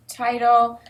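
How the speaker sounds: usual speaking voice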